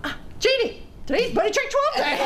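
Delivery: high, cheery call to a friend; calling across the aisle with delight; excited call. Retold or imitated. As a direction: greet her with a bright shout. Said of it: "and I went".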